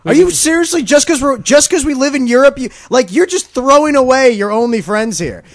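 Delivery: high, loud "are you not paying attention"; a wounded ally shrieking in disbelief; indignant France. Retold or imitated. France scolding Al Qaeda for bombing its only friends, high and outraged. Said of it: "France was just like".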